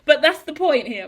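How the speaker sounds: real amused laugh; laughing at herself. Real, not acted. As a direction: laugh then speak through it. Laughs at own exaggeration.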